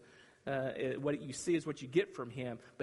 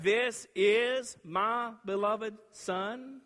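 slow, higher, louder proclamation; Father's declaration; 5 semitones higher, 5 dB louder, much slower than usual. Acted. Father declaring over the Son, slow and grand. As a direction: announce it grandly and slowly. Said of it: "the declaration from the Father".

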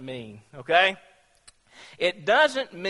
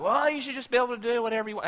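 fast, higher, tossed-off excuse; rushed mock quote; 5 semitones higher, much faster than usual. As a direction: rattle it off flippantly. Mock misreading of verse.

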